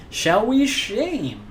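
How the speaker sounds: high, loud and drawn right out; playful, ringing, long-held proclamation; mock-grand invitation. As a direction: stretch it slowly like a showman's big call. Playfully proposing the shaming ritual to viewers.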